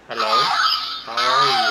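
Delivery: put-on loud childlike changer voice; child voice changer. Shown as a demonstration. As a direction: speak through the child setting. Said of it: "this one's child".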